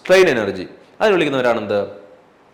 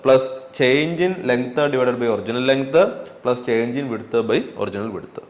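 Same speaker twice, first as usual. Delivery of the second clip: each word spaced out for notes; slow dictation; much slower than usual. Shown as a demonstration. Teacher dictating to the class, very slow. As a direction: dictate it slowly so students can write. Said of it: "dictating formula slowly".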